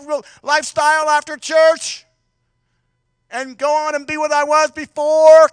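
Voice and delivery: high and loud full-force preaching; fiery preaching. Acted. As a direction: build the sermon to a fever pitch.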